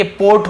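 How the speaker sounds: "पोट" with a drawn-out long o; stretched o in "पोट", testing the class; quiz option. Shown as a demonstration. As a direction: offer "पोट" slowly with a closed o. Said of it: "क्या होगा बताइए".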